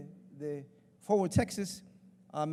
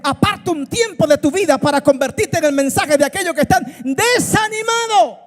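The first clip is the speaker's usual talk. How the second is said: loud, rising pulpit delivery; full-force preaching; 6 semitones higher, 15 dB louder than usual. Acted. Pastor urging the congregation to let go, full-force preaching. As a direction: preach it louder and higher to the crowd.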